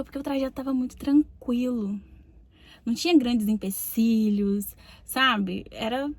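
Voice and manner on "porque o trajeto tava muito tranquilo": normal talking voice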